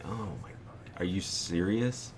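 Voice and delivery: hushed, slow disbelieving whisper; shocked whisper. Retold or imitated. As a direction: whisper it as if the news just floored you. Learns roommate skipped rent.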